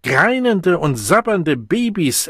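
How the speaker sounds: high, loud scornful taunt; provoked into rudeness. A narrator's reading. The Savage insults the unresponsive Deltas, irritated and loud. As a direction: hurl the insult, drawing out the words. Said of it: "bis zur Grobheit gereizt".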